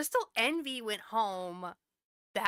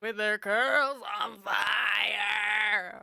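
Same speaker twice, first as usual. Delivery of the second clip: drawn-out shout; 5 dB louder, much slower than usual. Real, not acted. Joking exclamation, drawn out.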